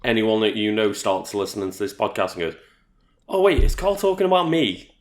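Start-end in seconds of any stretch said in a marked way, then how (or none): 3.3-4.9 surprised realisation. Pretend. Imagined friend realising.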